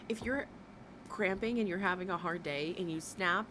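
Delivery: normal talking voice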